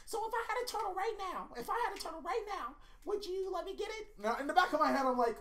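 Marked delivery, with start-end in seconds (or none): 0.0-4.1 high, soft, quick wheedling voice; wife on the phone. Retold or imitated. Wife calling a week later, high and soft. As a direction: coax it like his wife angling for a turtle. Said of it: "She was like".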